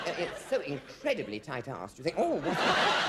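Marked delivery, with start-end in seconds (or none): 2.2-3.1 prissy mock shock. Mocking a prissy reaction.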